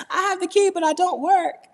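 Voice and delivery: high, laughing mock of her own clumsy line; mocking herself. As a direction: squeak it out, teasing your own broken Spanish. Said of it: "I sound stupid".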